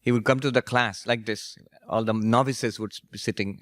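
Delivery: usual speaking voice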